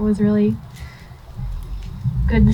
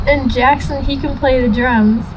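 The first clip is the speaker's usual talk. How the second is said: ordinary delivery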